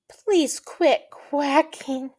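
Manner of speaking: slow, high plea; pleading. Acted. Quail asking noisy friend to stop, pleading. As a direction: stretch it out as a weary request.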